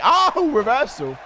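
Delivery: sudden high, loud surprised cry, slowed. Real, not acted. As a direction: caught off guard by the move. Reacting to a game reversal.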